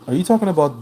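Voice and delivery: sudden high, loud question; surprised. Real, not acted. Streamer startled by a chat comment, surprised. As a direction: caught off guard, blurting it out.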